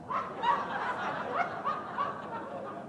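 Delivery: high breathy laugh. Real, not acted. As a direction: burst out laughing. Amused at the odd dog.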